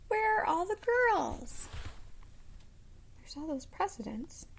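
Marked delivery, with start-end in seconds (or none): 0.1-1.7 put-on girl's dismay. Grace shocked at the poster.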